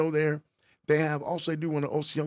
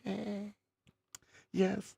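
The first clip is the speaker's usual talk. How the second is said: usual speaking voice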